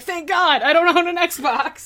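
high, loud burst of relief; losing their mind. Retold or imitated. Fan hears the game is coming to Steam, high excited outburst. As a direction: cry it out, overjoyed and relieved. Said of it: "I was losing my mind about that".

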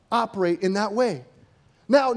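normal talking voice

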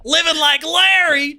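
loud, high, slow swaggering boast; beefy beach Larry. Pretend. Playing a muscle-beach character at the party, loud swaggering boast. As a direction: strut like a gym bro.

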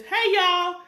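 loud, high, bright Southern "Hey, y'all"; Southern greeting. Shown as a demonstration. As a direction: greet folks the country way. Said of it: "a really good Southern greeting is".

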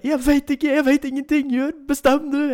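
very high, loud desperate crying out; sick partner wailing. Retold or imitated. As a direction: wail it in pain and confusion. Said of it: "Hun bare".

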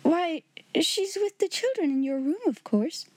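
high-pitched, flustered answer; bewildered. A narrator's reading. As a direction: answer as a puzzled, timid sister. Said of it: "she stammered".

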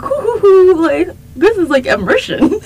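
high, loud giddy hooting; mock-thrilled newcomer loving the ritual; giddy excitement. Mimics own excitement at pepper ritual.

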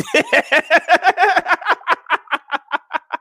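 high, rapid run of laughter; long amused laugh. Real, not acted. Host looking at the album cover, laughing hard. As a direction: crack up at length, high-pitched.

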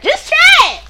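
very high, loud cheer; high-pitched excited call; excited catchphrase. Pretend. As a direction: shoot it up high like a jingle tag. Repeats segment name as catchphrase.

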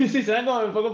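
quick laughing repeat; echoing a friend's joke while laughing. Real, not acted. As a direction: laugh through the words.

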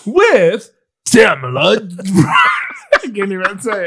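laughing while announcing; can't get the words out for laughing. Real, not acted.

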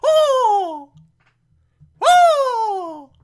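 very high, loud hooting squeal; hammed-up piercing coo of delight; Aunt Harriet. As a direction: hoot a sustained falsetto "oh". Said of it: "Aunt Harriet's bragging about how great".

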